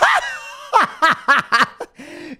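shrieking laugh, very high; loud delighted shriek-laugh; high burst of laughter. Real, not acted. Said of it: "laughing at the story".

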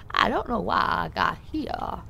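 slow, put-on southern accent. Puzzled at arriving here, slow southern accent. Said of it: "my southern accent just then".

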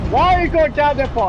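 high, loud demand; real heated gamer yell; exasperated shout. Real, not acted. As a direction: yell after a fleeing opponent. Chasing a fleeing enemy in game.

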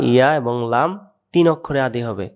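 ordinary delivery

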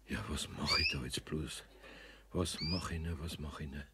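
verzweifelt. Acted. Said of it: "war wirklich verzweifelt".

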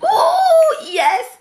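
high, loud squealed cheer; real excitement. Real, not acted. Owner cheers as her dog responds, excited squeal. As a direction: whoop in delight, very high. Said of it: "I don't know why I'm so excited".

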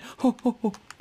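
loud, high-pitched laugh; high laughter. Real, not acted. Gamer cracking up at his shower joke, high laughter. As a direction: burst out in high hoots of laughter.

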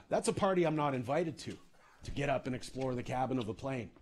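ordinary delivery

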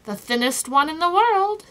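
chirpy and emphatic; bright reply. A narrator's reading. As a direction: answer brightly, pitch climbing. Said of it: "he replied brightly".

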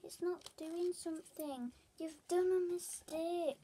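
high, slow mimicry of fussy people; mock fault-finding in a high voice; mocking complainers. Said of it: "Hate it when people are like".

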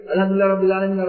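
rattled off low and soft, like a computer playback; quick mechanical gabble, no attention to the words; mindless rote recitation. Shown as a demonstration. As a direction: run through it on autopilot. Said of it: "அப்படியே ஓதிக்கொண்டே போவோம்".

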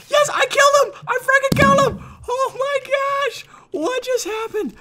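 very high squealing triumph; ecstatic disbelief. Real, not acted. Player stunned at killing his opponent, ecstatic. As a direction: squeal over a win you never expected. Unexpected kill in game.

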